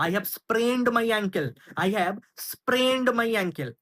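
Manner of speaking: very slow, clear teacher read; slow model phrase. Shown as a demonstration. Teacher models the English phrase, very slowly. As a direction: spell it out slowly for learners. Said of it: "তাহলে".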